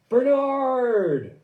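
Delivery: high, loud, drawn-out call of the name; triumphant announcement. Retold or imitated. Nella announcing the misheard band name to the crowd, loud call. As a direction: proclaim it to the whole crowd.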